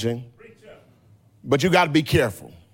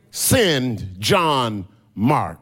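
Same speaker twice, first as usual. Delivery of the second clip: drawn out word by word, lower; slow solemn quote; 4 semitones lower, much slower than usual. Retold or imitated. Preacher voicing Paul asking for John Mark, slow solemn quote. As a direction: quote the request very slowly, spacing each word. Quotes dying Paul's last request.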